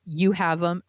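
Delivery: usual speaking voice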